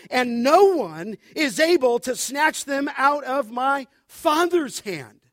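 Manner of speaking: loud, building scripture reading with slow emphatic end; thundering pulpit reading; preaching at full force. Acted. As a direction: proclaim the verse like a preacher.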